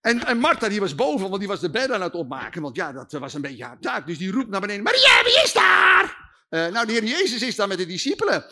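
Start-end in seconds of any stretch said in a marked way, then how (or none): 4.8-6.2 loud, high, slow call up the stairs; calling downstairs. Acted. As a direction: shout down from upstairs.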